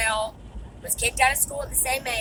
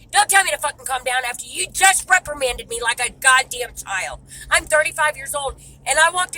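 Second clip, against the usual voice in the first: angry retort; 2 semitones higher, 7 dB louder than usual. Retold or imitated.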